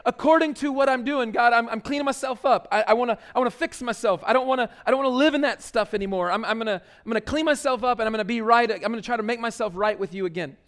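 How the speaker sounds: fast, eager mock promise to God; hammed-up rushed vow to clean up; imitating someone bargaining with God; self-justifying pledge. Voices self-improving pleader.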